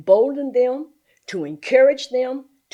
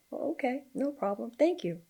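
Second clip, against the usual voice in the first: soft, higher, mild little reply; hushed, dutiful acceptance; quiet compliance; 4 semitones higher, 9 dB quieter than usual. Retold or imitated. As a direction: play along very softly. Acts out her meek compliance.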